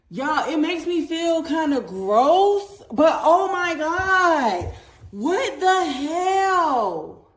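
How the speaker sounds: high, slow and loud with a laugh, stretched out; excited disbelief. Real, not acted. Showing viewers her vacuum's dirt, excitedly laughing. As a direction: gush over the dirt in real amazement. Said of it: "I'm so excited".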